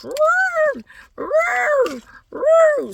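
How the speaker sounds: three short, very high trumpet calls; elephant trumpeting. Acted. Storyteller shows what the elephant was doing, trumpeting. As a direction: blare out mock elephant calls. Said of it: "the elephant was going like this".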